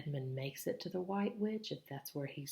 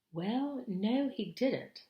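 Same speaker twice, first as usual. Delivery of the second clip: put-on high, slow and unsure girl's voice; Lucy stalls, reluctant to admit it; hesitant young girl; 8 semitones higher, 4 dB louder, much slower than usual. Lucy answering Mister Beaver, slow and unsure.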